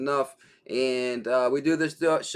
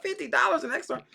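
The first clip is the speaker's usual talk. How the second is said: much higher, fast incredulous echo; disbelief; faster than usual. Retold or imitated. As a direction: throw it back in total disbelief. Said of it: "I said, "What?".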